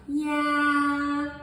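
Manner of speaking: "yeah" high, held long and trailing; hesitant dotted yeah. Shown as a demonstration. Teacher showing me'ek: held, high "yeah". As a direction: stretch "yeah" out like dot dot dot. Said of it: "It's where we're like".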